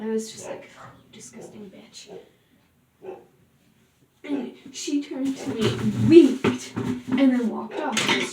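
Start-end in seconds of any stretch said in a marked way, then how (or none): 0.0-2.1 breathy muttered ugh, barely voiced; muttered insult. Watching the girl leave with him, muttered disgust. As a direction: sneer it under your breath. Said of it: "I even said it out loud".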